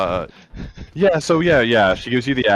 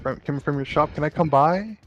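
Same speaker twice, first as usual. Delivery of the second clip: worried mother; 6 semitones higher than usual. Acted. GM voices worried mother on phone.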